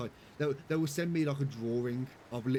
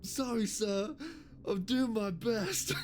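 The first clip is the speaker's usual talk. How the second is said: high, slow, dopey apology; cross-eyed gunner; 10 semitones higher, much slower than usual. Retold or imitated. Cross-eyed gunner apologising to his officer, high and slow. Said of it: "and he's like".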